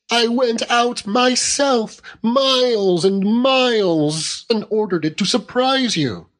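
put-on high aggrieved young wife, drawing out her hardships; childlike wife, indignant. A narrator's reading. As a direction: pile up the grievances petulantly.

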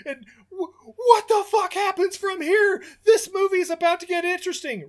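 very high, strained, worked-up rant; frantic mock outrage. Podcaster climaxing his imagined rant in the balloon, frantic outrage. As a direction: wail it like you are losing your mind.

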